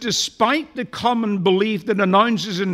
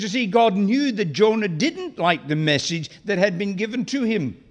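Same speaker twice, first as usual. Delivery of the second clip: ordinary talk, nothing marked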